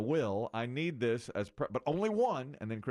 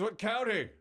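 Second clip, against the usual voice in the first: client's question; 8 semitones higher than usual. Retold or imitated. Client presses the host on his county, higher. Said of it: "He goes".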